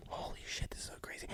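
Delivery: hushed, barely audible amazement; awed whisper. Retold or imitated. Released inmate putting his own clothes on, awed whisper. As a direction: whisper it to yourself in disbelief.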